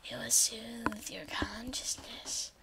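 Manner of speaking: a playful hypnotic hush; mock soothing whisper. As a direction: whisper it as if lulling them to sleep. Soothing whisper about the wand.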